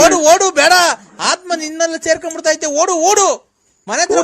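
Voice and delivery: very loud, high repeated commands; urgent shouting. Real, not acted. Warning of a spirit entering him, urgent loud shout. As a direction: yell at them to run.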